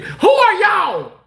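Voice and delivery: high, loud, slow demand; playful high yell at hidden voices; mock startled call. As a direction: call out as if hunting intruders.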